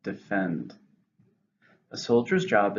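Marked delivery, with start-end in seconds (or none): 0.0-0.7 "Defend" stressed on FEND, softly falling; soft citation of "Defend", weight on the last syllable; stress on second syllable. Shown as a demonstration. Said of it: "stresses on the second".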